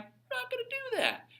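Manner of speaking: high mocking taunt, cheeky and defiant; mock the rebels in a squeaky sassy voice; smart-aleck defiance. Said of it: "they get all smart and like".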